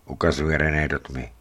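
usual speaking voice

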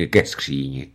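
ordinary delivery

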